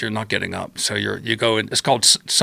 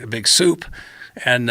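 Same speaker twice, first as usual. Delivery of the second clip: normal talking voice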